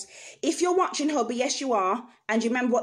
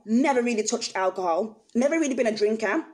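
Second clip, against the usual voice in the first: normal talking voice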